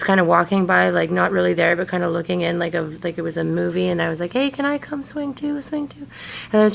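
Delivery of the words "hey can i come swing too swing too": put-on high, soft, childlike asking; childlike high plea. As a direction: ask sweetly like a small child. Retold own dream plea.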